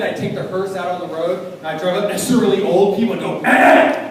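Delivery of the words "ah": loud comic "Ah!"; mock menacing scream. Hearse driver startling old people, mock menacing scream. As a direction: yell out to startle someone.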